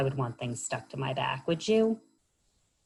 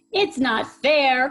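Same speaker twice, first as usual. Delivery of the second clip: high, louder, slow wail of complaint; crying out; 9 semitones higher, 7 dB louder, slower than usual. Acted. Hedgehog complaining about her crowded back, high wail. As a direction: wail it like a wronged little creature. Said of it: "cried Hedgehog".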